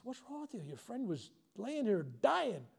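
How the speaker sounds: high, then slow, stunned reproach; incredulous scolding. Retold or imitated. Lifeguard confronting the beach girl, slow and reproachful. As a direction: drag out the accusation. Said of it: "and I goes".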